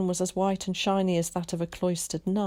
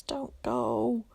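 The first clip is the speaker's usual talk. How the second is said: pleading; 7 semitones higher than usual. A narrator's reading. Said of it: "he pleaded".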